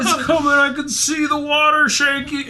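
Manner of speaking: high, rising movie-scene panic; mock movie panic. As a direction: play the scared dinosaur-movie victim. Jurassic Park scene acted.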